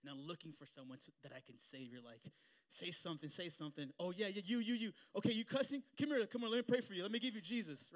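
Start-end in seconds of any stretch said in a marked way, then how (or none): 2.7-3.9 louder, pushy urging; mock eager nudge. Zealous convert urging someone. 4.0-7.9 much higher, loud, excited hammed-up zealot; hammed-up overexcited new believer; overeager pounce. Overzealous convert pouncing on sinner.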